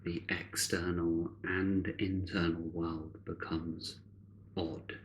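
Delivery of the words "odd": ordinary talk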